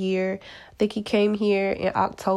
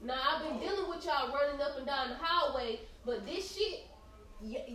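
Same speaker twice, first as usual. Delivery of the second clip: calling off mic; 7 semitones higher, 12 dB quieter than usual. Real, not acted. Podcaster telling the kids in the hallway to hush, off mic. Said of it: "'Cause I'm trying to do my podcast".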